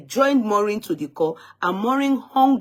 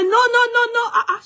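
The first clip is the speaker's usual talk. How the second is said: much higher, louder mock refusal; imitating a shout; 14 semitones higher, 4 dB louder than usual. Host mimicking the woman refusing on the phone, high mock shout. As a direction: throw back her refusal like a shout. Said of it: "she was shouting".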